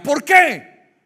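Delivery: loud, high, sharp challenge; shouted question. Acted. Preacher to congregation, a sudden loud challenge. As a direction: hurl the question at them.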